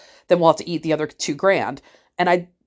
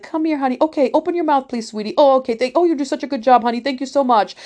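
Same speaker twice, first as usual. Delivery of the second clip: put-on high-pitched, sugary dentist coaxing a child; imitated cooing, praise-heavy chatter; super nice, talkative; 7 semitones higher than usual. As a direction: gush sweetly at a small patient. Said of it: "he's super nice and the kids love him".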